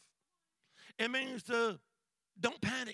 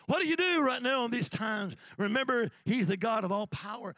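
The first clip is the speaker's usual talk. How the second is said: full-force preaching; 3 semitones higher, 7 dB louder than usual. Acted. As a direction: preach it out to the crowd, loud.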